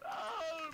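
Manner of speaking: high startled yell of "oh"; a sharp cry; sudden shout. Real, not acted.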